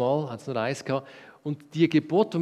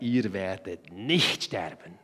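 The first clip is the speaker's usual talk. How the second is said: low, slow hissed snake voice; a sly snake hissing a lie; 8 semitones lower, slower than usual. Acted. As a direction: let the serpent hiss it, low and slow. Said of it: "zischte die Schlange".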